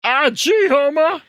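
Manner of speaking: loud, high-pitched cartoon drunk; full-out cartoon impression; Barney Gumble. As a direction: belt it out high as a cartoon barfly. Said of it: "I do a really good Barney Gumble".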